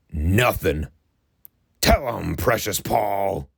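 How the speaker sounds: flat deadpan, dragging out Precious Paul slowly; deadpan Hawk. Retold or imitated. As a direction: stare him down and say it dead flat. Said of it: "he goes".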